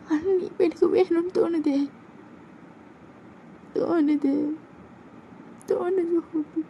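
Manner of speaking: high and soft, fading; a girlfriend confessing love through tears; soft high voice, trailing away; tender confession. Acted.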